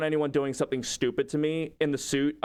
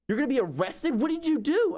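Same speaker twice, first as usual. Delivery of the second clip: shocked; 8 semitones higher than usual. Retold or imitated. Business owners shocked he may be arrested, much higher. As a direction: gasp it out in alarm.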